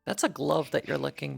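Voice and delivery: normal talking voice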